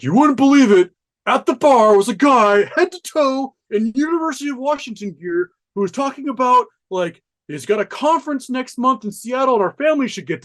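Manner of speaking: high, loud, breathless husband report; a retold husband, half amazed, half thrilled on the phone; excited retelling. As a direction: gush it out like you can't believe it. Husband excitedly calls wife from Russia.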